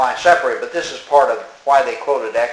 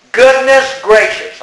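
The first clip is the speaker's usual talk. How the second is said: high, loud, slow, hammed-up gasp of "Goodness gracious"; mock genteel exclamation; 9 semitones higher, 6 dB louder, slower than usual. Mocking those who say it, a high, slow hammed-up exclamation. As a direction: play the scandalised church lady. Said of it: "Don't say things that are tied in".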